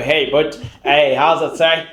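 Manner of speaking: put-on college boy English accent; proper college boy accent. Shown as a demonstration. Showing off imitations of people, proper college boy accent. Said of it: "like a proper college boy".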